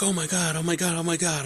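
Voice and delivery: dad pretending the car is out of control; mock panic. Dad fakes panic, prank.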